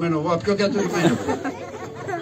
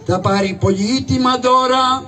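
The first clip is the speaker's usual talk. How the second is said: town crier's call; 4 semitones higher than usual. Acted. Crier proclaims the prize to the townsfolk, calling out. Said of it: "να βγω να τελαλήσω".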